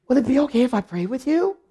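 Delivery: mock astonished asking, rising at the end; awed request. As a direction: imitate her asking, suddenly awestruck. Deacon asks after learning of pastor.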